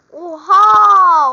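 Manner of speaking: loud startled exclamation; shocked oha. Real, not acted. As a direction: blurt it out in shock. Rare item seen in trade. (eşya görünce şaşkın bağırış)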